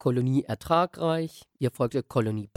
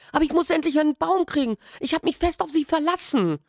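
high, fast, pleading; rushing the words out in despair; boy begs the old man for a tree, high and fast; desperate boy; 3 dB louder than usual. Acted. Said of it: "Vor Verzweiflung sprach ich".

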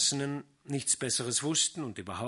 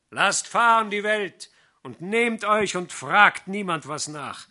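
loud, high, friendly persuasion; hearty fiddler; 5 semitones higher, 12 dB louder than usual. A narrator's reading. Fiddler coaxing the lovers to join the homeless band, loud and hearty. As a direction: sell the free life in the woods with gusto.

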